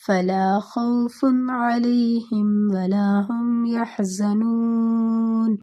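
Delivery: slow, drawn-out chanted verse; Quran recitation. Shown as a demonstration. Teacher reciting Al-Baqarah verse for the class, slow chanted recitation. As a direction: recite with smooth tajweed, stretching the vowels. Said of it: "یہ سورۃ البقرہ کی thirty ایٹ آیت ہے".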